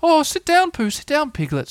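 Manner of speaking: very high, hospitable owl voice; kindly Owl. A narrator's reading. Owl welcomes guests indoors, high kindly voice. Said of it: "said Owl kindly".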